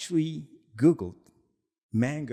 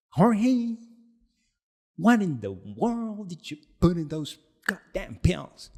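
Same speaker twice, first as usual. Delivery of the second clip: high, loud hammed-up accusation; imitating an angry caller; mock angry demand; 7 semitones higher, 4 dB louder than usual. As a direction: shout it like a friend who is fed up. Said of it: "he was angry".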